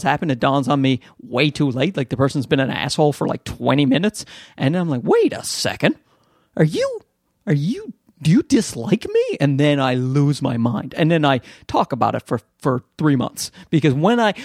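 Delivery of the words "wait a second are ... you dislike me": high-pitched mock incredulity; dawning disbelief. Acts out his own dawning realisation.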